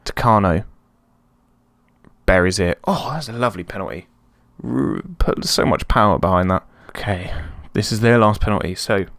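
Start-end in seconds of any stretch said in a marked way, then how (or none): none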